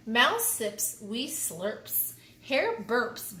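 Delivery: plain reading voice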